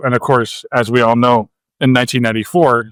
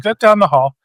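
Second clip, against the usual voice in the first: casual mock answer; 6 semitones higher than usual. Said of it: "And he's like".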